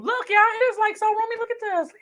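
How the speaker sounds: excited. Real, not acted. Showing off the bag.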